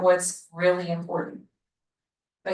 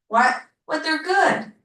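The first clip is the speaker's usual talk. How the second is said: lightly acted, higher-pitched objection; mock protest; 5 semitones higher than usual. Teacher voicing a churchgoer who objects to being judged, mildly indignant.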